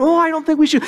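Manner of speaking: a hint of nervous Smeagol pleading; timid Smeagol. Smeagol arguing with Gollum, meek and lightly voiced. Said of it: "he's yelling at himself".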